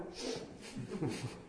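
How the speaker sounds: a quiet breathy chuckle; short hushed laugh; soft laugh. Real, not acted. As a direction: laugh lightly at the idea. Laughing at own joke.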